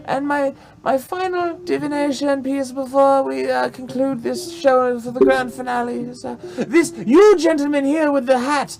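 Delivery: high-pitched stage hostess; show madam. Acted. Madam picks her final divination guest, high and theatrical. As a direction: announce it in a high, showy madam voice.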